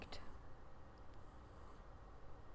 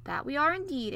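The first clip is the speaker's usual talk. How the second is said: sobbing; 7 semitones higher, 5 dB louder than usual. A narrator's reading.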